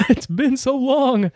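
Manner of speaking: high, slow put-on rapper voice; [name] impression. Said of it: "a great [name] impression".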